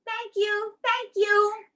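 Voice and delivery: high, sweet little puppet voice; a shy puppet thanking the children; Goldie the puppet. Acted.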